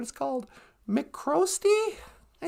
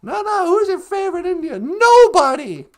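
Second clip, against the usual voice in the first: higher insistent question, then a loud NOBODY; insistent retold exchange; 3 semitones higher, 10 dB louder than usual. Retelling a heart-wrenching movie moment, insistent and loud. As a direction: press the question, then belt out the answer. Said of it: "John McCormick goes".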